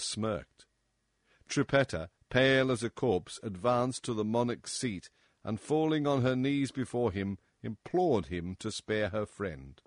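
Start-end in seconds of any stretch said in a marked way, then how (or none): none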